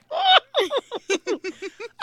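squeaky raspy comic laugh; Zacarias laugh. Shown as a demonstration. (risada rouca e aguda de imitador)

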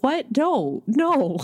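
lightly acted disbelief, pitched high; mock incredulity. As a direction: play baffled protest, voice jumping up. Retold disbelief at others' answer.

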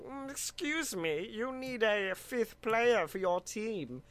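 soft, mock German-accented alien; quietly impersonating Marvin with a German accent. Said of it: "I don't know why he was German".

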